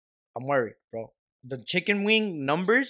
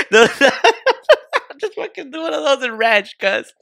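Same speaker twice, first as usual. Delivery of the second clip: laughing; 12 semitones higher, 7 dB louder than usual. Real, not acted. Laughing about the ranch michelada idea.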